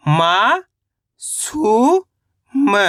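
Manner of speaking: मा and सु stretched with rising pitch, then short म; sound by sound. Shown as a demonstration. Teacher breaking a word down for learners, syllable by syllable. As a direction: chant मा ... सु ... म with pauses. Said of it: "तो मिलके बन जाएगा".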